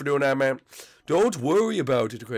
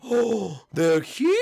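excited gasp; 6 semitones higher, much slower than usual. Acted. Blimpy sees crew arrive.

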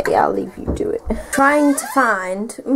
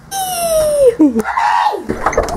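laugh. Real, not acted. Laughing at her chicken nuggets.

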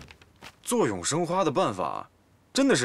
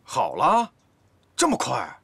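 sharp questioning "really?"; surprised; 4 dB louder, slower than usual. Acted. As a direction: blurt it out in disbelief. Surprised at quick recovery.

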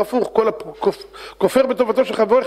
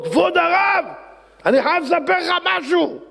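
higher, excited driver voice; eager taxi driver; 10 semitones higher than usual. Acted. Driver eagerly tells the rabbi his army story, higher voice. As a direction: blurt it out like a keen cabbie.

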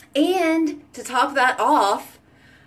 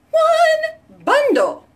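much higher, slow, louder squeal of excitement; squealed repeat; 6 dB louder, much slower than usual. Real, not acted. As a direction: stretch it out like a thrilled shriek. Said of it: "Get it in one bundle".

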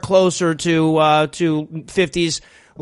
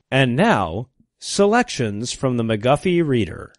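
lower, softer formal introduction; mock announcer; 7 semitones lower, 3 dB quieter than usual. Host introducing a primer parody, low mock-solemn announcement.

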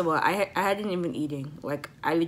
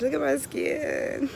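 slow, higher, showing off her glow; admiring; 7 semitones higher, 3 dB louder, slower than usual. Real, not acted. Vlogger to viewers, preening over her sunlit skin. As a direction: savor it, proud of your skin.

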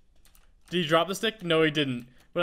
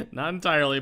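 ordinary talk, nothing marked

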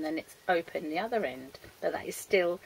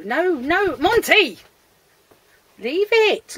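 high, loud command; exasperated yell to leave it; shouted scolding; 8 semitones higher, 10 dB louder, slower than usual. Real, not acted. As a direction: call the pet off urgently. Scolding pet.

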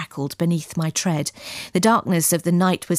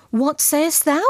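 higher-pitched, startled question; startled cry; 7 semitones higher than usual. A narrator's reading. Esau questions Harold's plea, startled cry. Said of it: "cried Esau".

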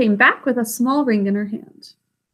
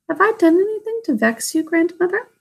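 a lightly voiced pitiful plea, higher and fast; pitifully; 7 semitones higher than usual. A narrator's reading. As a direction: ask as a hurt child who fears she did wrong. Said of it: "said Irene pitifully".

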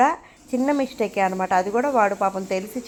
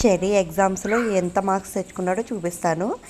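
normal talking voice

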